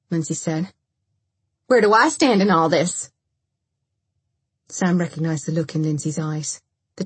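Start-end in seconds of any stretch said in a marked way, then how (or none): 1.7-3.1 raised, loud demand; bitter confrontation. A narrator's reading. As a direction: demand an answer, hurt and angry. Lindsay confronts Dennis at gunpoint.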